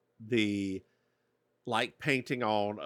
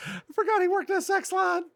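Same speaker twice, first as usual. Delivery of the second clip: high put-on voice; faster than usual. Sudden high voice.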